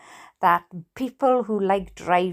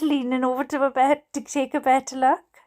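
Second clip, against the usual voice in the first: ordinary delivery, nothing marked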